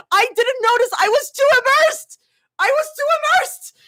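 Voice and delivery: high, loud protest; worked-up high whine; wailing excuse. Real, not acted. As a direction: wail it in your own defence.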